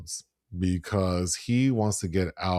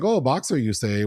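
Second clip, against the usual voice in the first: hammed-up Italian accent, rising high; a light mock Italian mobster; bad Italian accent; 5 semitones higher, 3 dB louder than usual. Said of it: "a bad Italian accent".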